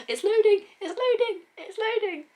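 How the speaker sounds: high giggly chant; giddy giggling. Real, not acted. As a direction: giggle it out in suspense. Giggling while it loads.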